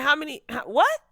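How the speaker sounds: high, loud, rising "what?"; incredulous. Retold or imitated. Reacting to Sabrina's claim, high and incredulous. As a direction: shoot up in disbelief. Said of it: "I'm like".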